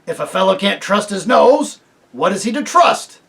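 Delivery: loud, insistent bulldog. A narrator's reading. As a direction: dig in stubbornly. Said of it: "said the bulldog".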